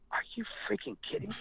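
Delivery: hushed, high-pitched disbelief; imitated stunned listener; incredulous. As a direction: squeak it softly, as if astounded. Retells listeners' disbelief at a story.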